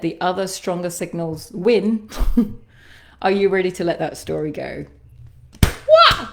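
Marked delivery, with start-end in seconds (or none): 5.6-6.3 ecstatic shriek. Real, not acted. Energy release after clearing statement.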